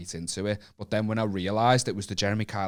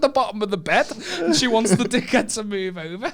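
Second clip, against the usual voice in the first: laughing retelling; 13 semitones higher, 8 dB louder than usual. Retold or imitated. Laughing mock of the girl.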